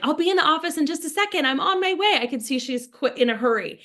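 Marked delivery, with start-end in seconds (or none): 0.0-2.2 higher, quicker voice of a rushed woman; busy woman on her phone; hurried phone caller. Acted. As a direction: rattle it off as if dashing to the office. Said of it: "I see her on the phone going".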